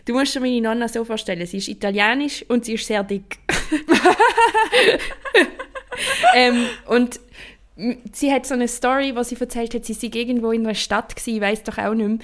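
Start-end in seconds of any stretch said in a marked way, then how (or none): 3.4-6.3 hoher, langer Lachanfall; laut herauslachen, immer höher; hohes Lachen. Real, not acted. Lachanfall über Nonna. (lautes, hohes Lachen)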